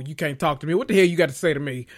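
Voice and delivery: ordinary talk, nothing marked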